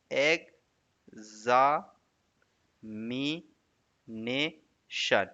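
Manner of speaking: "एग्जामिनेशन" very slowly, a long pause after each syllable. Shown as a demonstration. Teacher counting syllables, "एग्जामिनेशन" slowly split. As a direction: break "एग्जामिनेशन" into five separate, drawn-out syllables. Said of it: "अब देखो कितना हो सकता है".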